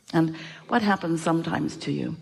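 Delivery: ordinary talk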